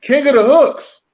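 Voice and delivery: loud, high-pitched name call; hyped shout-out. Real, not acted. Co-host shouting out a favourite singer, hyped. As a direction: belt out the act's name with hype.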